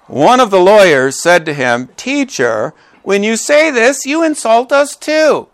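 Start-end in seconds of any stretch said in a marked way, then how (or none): 1.9-5.5 indignant protest climbing high; mildly acted offended lawyer. Lawyer protesting to his teacher, high mock offence. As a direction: object as if mildly wounded, pitch rising.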